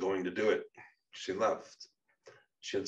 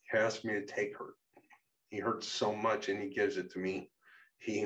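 plain reading voice

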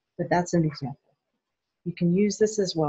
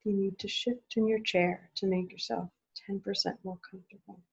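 softer, unhurried questions; guided check-in; 4 dB quieter than usual. Acted. Speaker asks tired parents what they need, soft and unhurried. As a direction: ask gently, leave space.

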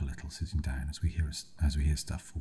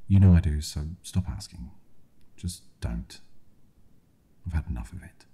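angry; 6 dB louder than usual. Acted. Fed-up partner snaps back.

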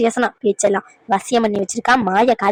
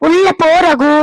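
louder, higher plea to go inside; raised urgent plea; 4 semitones higher, 4 dB louder than usual. Acted. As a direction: beg him firmly to leave. Mother repeats louder to son. (உள்ள போ ரகு என்று உரத்த குரலில் கெஞ்சல்)

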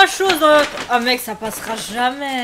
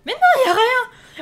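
laughing; 11 semitones higher, 5 dB louder, slower than usual. Real, not acted. Incrédule devant la bouteille.

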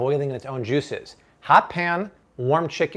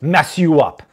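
ominous warning; 3 semitones higher, 9 dB louder, slower than usual. Pretend. Ominous warning about cold chicken.

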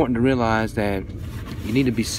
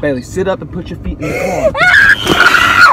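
loud, higher, slow desperate command; urgent real shout; 8 semitones higher, 9 dB louder, slower than usual. Real, not acted. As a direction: shout at her to sit up, scared. Parent pleading with distressed child.

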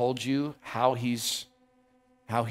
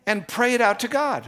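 ordinary talk

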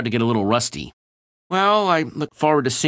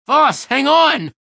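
shout; 7 dB louder, slower than usual. Acted. Voss in danger.

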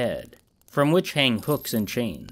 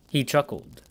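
ordinary delivery, nothing marked

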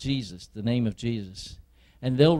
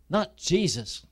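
normal talking voice

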